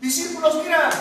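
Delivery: slowed, high reproach; indignant demand. Retold or imitated. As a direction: scold like an annoyed Pharisee. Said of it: "le dicen los fariseos".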